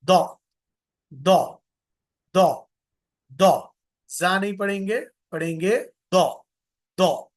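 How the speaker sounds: teaching a student the heavy d sound of दो; heavy dental d. Shown as a demonstration. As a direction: make the d of दो full and thick, close to a d. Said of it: "लेकिन मोटा करके पढ़ेंगे".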